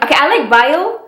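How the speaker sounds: normal talking voice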